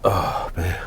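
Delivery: real tears held back, voice catching; fighting not to cry while talking; getting emotional. Real, not acted. Vlogger in his van chokes up about healing, holding back tears. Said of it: "I'm getting emotional".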